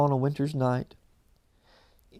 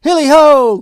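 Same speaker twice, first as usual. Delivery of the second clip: much higher and louder hearty call; jovial cry; 10 semitones higher, 13 dB louder than usual. A narrator's reading. Fezziwig rousing his lads, jovial whoop. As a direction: whoop it out with glee. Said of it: "cried old Fezziwig".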